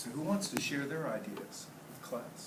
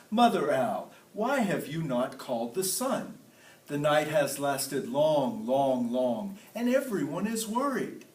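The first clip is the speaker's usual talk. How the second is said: king's voice; 3 semitones higher, 7 dB louder than usual. Acted. King lion's question in the tale.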